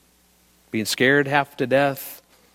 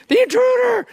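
high, loud cry of alarm; hammed-up terrified yell; mock panic; 5 dB louder than usual. Retold panic at being grabbed.

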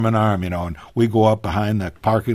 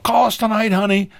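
retold yell; 11 semitones higher than usual. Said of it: "I yelled".

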